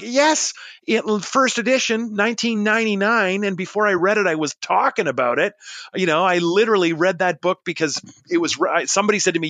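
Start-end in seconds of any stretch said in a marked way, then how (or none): 0.0-5.5 high-pitched, eager reply; retold excited yes. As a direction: recount it with pitched-up enthusiasm. Retold enthusiastic answer about shared book.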